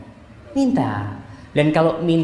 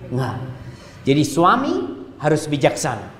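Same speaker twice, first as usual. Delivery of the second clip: normal talking voice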